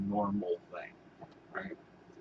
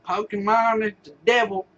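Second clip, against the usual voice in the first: higher, louder hammed-up preacher; mock pastor; 10 semitones higher, 5 dB louder than usual. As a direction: sermonize like a frantic televangelist. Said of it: "But now he's like".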